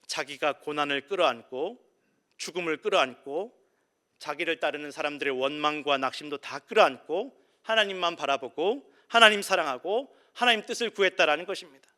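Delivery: rising preacher's fervor; preaching at full force. Acted. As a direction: drive the point home to the congregation. Preaching builds in volume.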